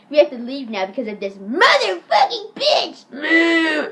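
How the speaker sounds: hammed-up cursing that ends in a boo; mock angry insult. [name] tells his dog about the teacher, mock shout. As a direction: build to a loud, slow shout.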